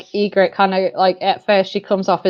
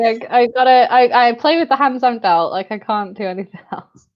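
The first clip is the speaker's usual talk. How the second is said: ordinary talk, nothing marked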